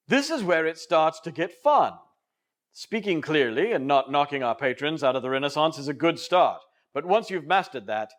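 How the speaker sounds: ordinary talk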